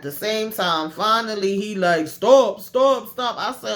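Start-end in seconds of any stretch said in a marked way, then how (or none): 2.1-3.4 loud, higher-pitched mock plea; retold yell for mercy; pleading cry. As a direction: cry out as if being beaten. Acted captive begging beating to stop.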